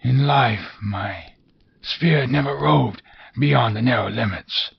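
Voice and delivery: high, wailing otherworldly voice; mournful ghost. Acted. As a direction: lament like a doomed spirit.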